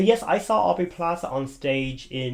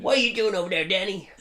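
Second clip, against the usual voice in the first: a hammed-up New York screech, calling to Danny; New York accent; 7 semitones higher than usual. Actress on stage yelling at Danny in a hammed-up New York accent. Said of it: "full on, like, New York kind of way".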